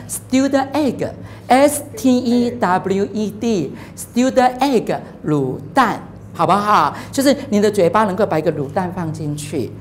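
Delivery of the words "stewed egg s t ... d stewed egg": Stewed egg spelled out letter by letter, slowly; slow spelling. Shown as a demonstration. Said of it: "卤蛋英文怎么说呢". (慢慢地一个字母一个字母拼)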